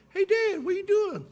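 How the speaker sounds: put-on high, loud kid on the phone; high child's voice calling Dad; little boy voice. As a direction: chirp it like an excited young son. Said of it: "He goes".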